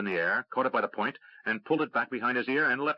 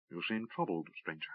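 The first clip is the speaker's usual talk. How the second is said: calm elf; 3 semitones lower, 8 dB quieter than usual. A narrator's reading.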